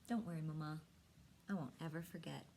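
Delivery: usual speaking voice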